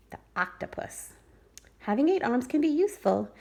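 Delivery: plain reading voice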